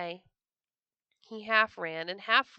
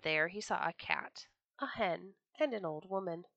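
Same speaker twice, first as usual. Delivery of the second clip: ordinary delivery, nothing marked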